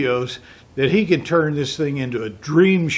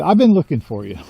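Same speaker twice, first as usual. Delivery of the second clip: usual speaking voice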